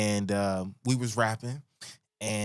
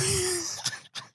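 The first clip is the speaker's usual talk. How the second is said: high-pitched laugh; 3 dB quieter than usual. Real, not acted. Laughs at his own joke.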